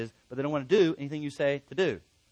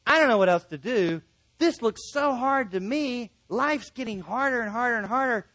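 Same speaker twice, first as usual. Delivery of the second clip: higher, emphatic acted exasperation; frustrated complaint; 7 semitones higher, 5 dB louder than usual. Struggling person thinking life is too hard, frustrated. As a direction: act out being at a loss, drawing it out. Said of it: "when you get frustrated".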